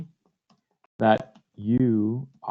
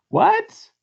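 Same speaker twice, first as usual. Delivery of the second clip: sharp intake, very high; shocked gasp. Acted. Genie shocked in story.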